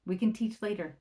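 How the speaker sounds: normal talking voice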